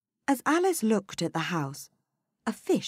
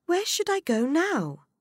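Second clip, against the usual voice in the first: put-on higher girl's voice; young Alice voice; 13 semitones higher, 4 dB louder than usual. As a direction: wonder it like a curious girl. Alice speaks.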